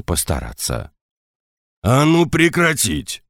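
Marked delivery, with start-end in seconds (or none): 1.8-3.2 high, slowed, forceful barked order; commanding bark. Acted. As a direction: bark it with full lungs. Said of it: "рявкнул на десятника я во всю мощь лёгких".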